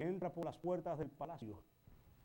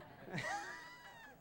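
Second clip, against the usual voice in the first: sudden high laughing shout; 5 dB quieter than usual. Real, not acted. Congregation laughs at the joke.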